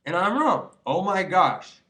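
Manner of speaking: loud, high burst of shock at himself; surprised outburst. Real, not acted. Admitting he was wrong, then loud amazed shock. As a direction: blurt it out, stunned by your own words.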